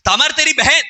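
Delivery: can't believe he means his own sister; shocked disbelief. Retold or imitated. Friend shocked that Amnon wants his sister, incredulous.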